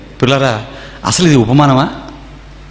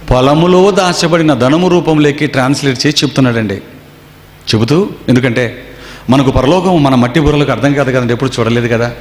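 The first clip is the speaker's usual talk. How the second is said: ordinary talk, nothing marked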